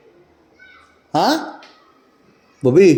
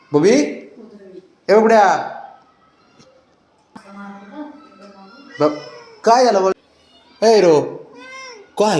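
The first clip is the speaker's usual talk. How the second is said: high pet talk; 8 semitones higher than usual. Real, not acted. Man greeting his excited dogs at the door, high sing-song pet talk. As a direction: gush over the excited dog in a high voice. Calling the pup fondly.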